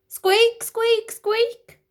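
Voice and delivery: high squeaking pram wheel; shrill high wheel squeaks; squeaky wheel noise. A narrator's reading.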